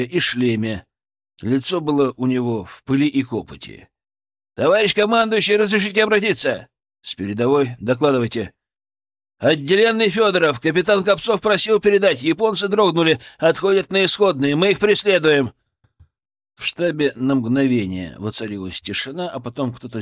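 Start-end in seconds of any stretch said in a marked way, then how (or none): 4.6-15.5 urgent report. Acted. Breathless soldier reports from front.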